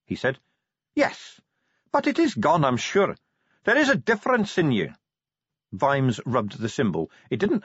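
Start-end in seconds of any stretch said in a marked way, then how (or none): 1.0-4.9 high-pitched Grag voice; Grag's reassurance. Acted. Grag assures Vimes it is gone, high-voiced. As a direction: reassure him in a high, lifted voice.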